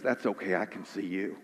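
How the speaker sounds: father calming his son at the window, hushed and tender; gentle father. Retold or imitated. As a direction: soothe a frightened boy softly. Father reassures scared son, story.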